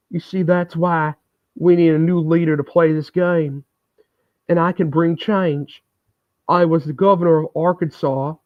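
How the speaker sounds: a hammed-up Bill Clinton impression. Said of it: "That's my impression of Bill Clinton".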